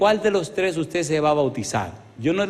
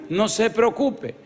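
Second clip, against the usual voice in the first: "No se preocupe" again, high and slow but gentler; Sonia, repeating; 8 semitones higher, slower than usual. Retold or imitated. Pastor voices Sonia repeating herself. (Sonia repite, voz aguda, lenta y más suave)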